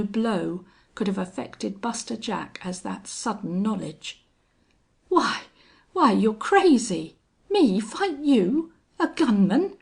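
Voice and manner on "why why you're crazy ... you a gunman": high, loud, halting incredulity; stammering. A narrator's reading. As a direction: falter and restart in alarmed disbelief. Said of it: "he stammered".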